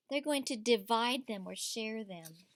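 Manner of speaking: ordinary delivery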